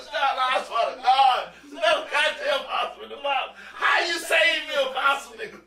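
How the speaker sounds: high, stretched comic panic; retold frantic outcry. Protesting another possum in the box, comic panic. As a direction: yelp it high with laughter underneath.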